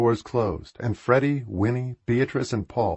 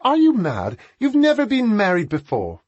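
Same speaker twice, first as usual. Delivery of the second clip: high, louder scolding; 12 semitones higher, 5 dB louder than usual. A narrator's reading. Sister scolding brother who kneels to propose, high loud scold. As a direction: scold him sharply, pitched right up. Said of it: "Beatrice scolded him".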